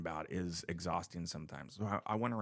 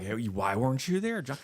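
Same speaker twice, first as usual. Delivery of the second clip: louder, higher imitation of nosy friends; mock loud questioning; 6 semitones higher, 7 dB louder than usual. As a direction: call it out like complaining pals. Imitates people questioning his absence.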